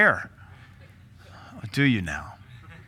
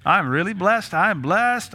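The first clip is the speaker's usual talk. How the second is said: pitched higher, bright lift on blessed; mock cheerful; 5 semitones higher, slower than usual. Shown as a demonstration. Pastor to church, hammed-up cheerful blessing. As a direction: sell it like a grinning believer. Repeating a grateful phrase.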